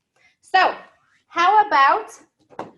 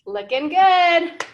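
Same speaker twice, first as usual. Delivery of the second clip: slow, higher, louder cheer; looking good cheer; 5 semitones higher, 4 dB louder, slower than usual. Shown as a demonstration. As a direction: strike a pose and cheer it. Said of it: "our looking good cheer".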